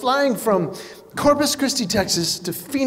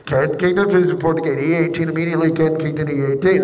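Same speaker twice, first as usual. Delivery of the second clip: mock PA announcement; 6 semitones lower than usual. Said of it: "over the loudspeaker".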